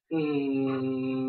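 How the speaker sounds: practice hum for the R; throat hum demo. Shown as a demonstration. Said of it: "mình làm như này".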